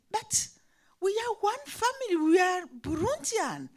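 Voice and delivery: high, fast pleading insistence; urgent plea. Retold or imitated. Teacher pleading with her pupil, high and urgent.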